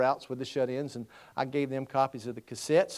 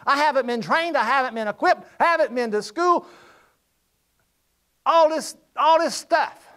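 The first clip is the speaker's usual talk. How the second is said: whiny protest; 10 semitones higher, 8 dB louder than usual. Retold or imitated. Voicing a reluctant preacher's protest.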